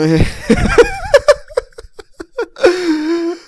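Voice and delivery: high shrieking laugh. Real, not acted. Laughing at the Discord Nitro joke.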